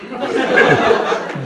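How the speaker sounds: breathy high laugh; airy laugh. Real, not acted. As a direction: laugh out in delight. Laughs after son's answer. (śmiech z radości)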